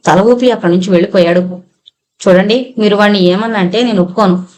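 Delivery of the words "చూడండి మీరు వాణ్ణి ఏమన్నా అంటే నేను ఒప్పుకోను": plain reading voice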